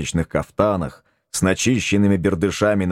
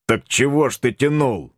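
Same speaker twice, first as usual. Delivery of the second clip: mildly indignant, pitched higher; a touch of royal outrage, raised pitch; 6 semitones higher than usual. A narrator's reading. Tsar scolds his detective for not marrying, mildly indignant. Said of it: "возмутился царь".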